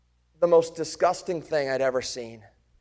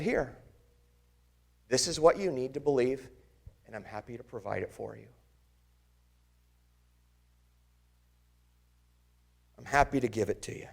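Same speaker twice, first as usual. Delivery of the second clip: much softer and lower, quick and tender; gentle reassurance; 4 semitones lower, 7 dB quieter, faster than usual. Acted. Jesus offering his wounds to Thomas, soft and tender. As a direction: offer it kindly, almost hushed.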